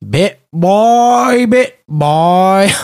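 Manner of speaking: slow, drawn-out Newfie "Bit by bit by"; Newfoundland accent. Retold or imitated. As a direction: stretch it out like a Newfoundlander. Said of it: "he said in a very Newfie way".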